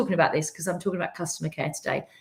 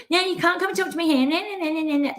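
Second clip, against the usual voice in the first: snapping cashier; 8 semitones higher than usual. Retold or imitated. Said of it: "She goes".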